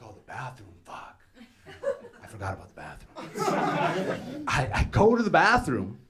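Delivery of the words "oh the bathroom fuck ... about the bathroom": sinking realization. Acted. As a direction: murmur it with dawning dread. Son realizing what he forgot.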